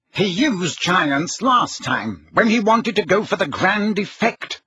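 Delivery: high-pitched, flustered official; harried Fudge. A narrator's reading.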